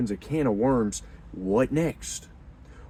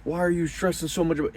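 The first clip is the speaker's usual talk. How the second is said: higher, mock-apologetic pleading; lightly hammed-up sorry boyfriend, fast at the end; groveling boyfriend; 5 semitones higher, 3 dB quieter than usual. Man mocks a boyfriend apologizing to jealous girlfriend, higher. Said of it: "If you're like".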